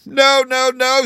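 high, loud sobbed protest; sobbing. A narrator's reading. Kangaroo rejects the wizard's wrong guess, sobbing. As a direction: wail each word through hammed-up sobs. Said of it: "sobbed the kangaroo".

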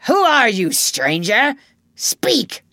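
high, loud challenge to a stranger; demanding. A narrator's reading. Hook questions the unseen voice, demanding. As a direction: challenge forcefully, ending on a sharp order. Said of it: "Hook demanded".

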